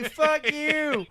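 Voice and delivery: very high, strained mock yell; a faraway holler played for laughs; mock shout. Co-host joins the bit as the guy under the car, mock shouting. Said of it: "The guy under the car shouts".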